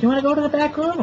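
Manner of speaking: put-on very high, squeaky girly voice; mock bimbo stripper. Mocking the second stripper asking for his lap, squeaky high.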